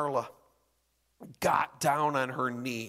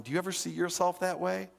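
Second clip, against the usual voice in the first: ordinary talk, nothing marked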